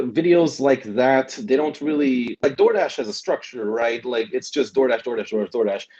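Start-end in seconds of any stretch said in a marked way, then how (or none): none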